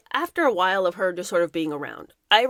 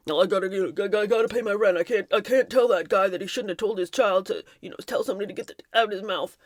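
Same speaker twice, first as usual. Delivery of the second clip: mumbled, hesitant excuse in a put-on voice; excuse-making bystander. Crew member excusing silence over a child's line, mumbled mock excuse. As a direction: make lame excuses as a cowed employee.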